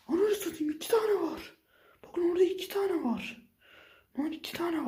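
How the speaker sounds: high-pitched disbelief at two monsters; startled high cry; shocked outburst. Real, not acted. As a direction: squeal it out in shock.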